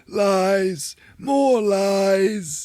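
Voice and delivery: high, strained, drawn-out rebel elder voice; over-the-top Forrest Whitaker impersonation; Forrest Whitaker as Saw. Retold or imitated. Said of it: "that's what fucking For-, Forrest Whitaker sounds like in this movie".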